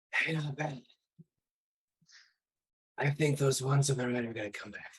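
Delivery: airy, distant ghost voice, urgent at the end; ghostly voice. Acted. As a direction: speak like a spirit warning a friend. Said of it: "this ghostly voice that is just like".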